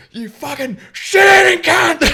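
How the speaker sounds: high, full-volume imitated rage; retold yelling. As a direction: shriek abuse like an enraged driver. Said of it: "who's yelling at him".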